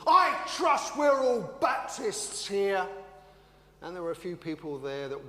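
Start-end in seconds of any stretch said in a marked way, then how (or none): 0.1-2.9 a lightly voiced fiery preacher, higher, louder and slow. Baptist preacher greeting the congregation, mildly hammed up. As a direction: ham up a pompous opening line. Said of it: "as he always did".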